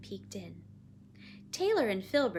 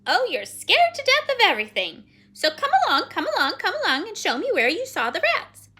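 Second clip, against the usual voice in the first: high, loud, swooping scolding; bossy, exasperated Penelope; 11 semitones higher, 13 dB louder than usual. Acted. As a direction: nag loudly with swooping repeats. Figure of Penelope.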